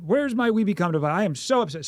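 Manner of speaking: lightly hammed-up "I'm upset" act; pretend upset. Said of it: "you pretend that you're upset".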